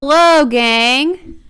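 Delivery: drawn-out cheery hello to the camera; loud slow greeting. Real, not acted. Greeting the viewers with a loud drawn-out hello. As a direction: boom out a slow welcome.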